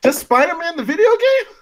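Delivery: high-pitched, disbelieving question; incredulous. Real, not acted. Co-host questioning a very short answer, incredulous. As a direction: question it as if you can't believe it.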